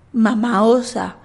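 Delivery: ordinary delivery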